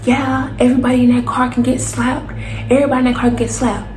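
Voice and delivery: high, yelling threat from a stranger; threatening drunk man. Retold or imitated. Stranger on drugs yelling threats at the car. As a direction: bark it at the whole car. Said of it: "he's like".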